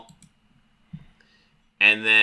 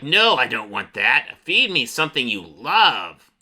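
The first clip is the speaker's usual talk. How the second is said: demanding creature with stretched words; monster voice. Acted. Monster demanding food from the boy, creature voice. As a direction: draw out the key words like a monster. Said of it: "the monster talks to him".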